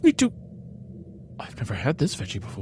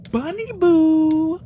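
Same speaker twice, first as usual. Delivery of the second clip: very high, slow, louder sing-song call; 5 dB louder, slower than usual. Retold or imitated. Owner calling her bunny, sing-song. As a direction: call the pet from the door.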